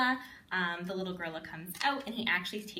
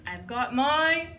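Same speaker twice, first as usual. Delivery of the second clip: slow, louder build then gasp; suspense pause; 6 semitones higher, 6 dB louder, slower than usual. Shown as a demonstration. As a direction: hold them in suspense. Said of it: "what we normally do".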